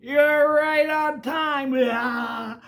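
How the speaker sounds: high, loud, drawn-out shout; grand surprise greeting, stretched out; joyful cry. Acted. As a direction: call out with a big smile. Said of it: "She cries with a big smile".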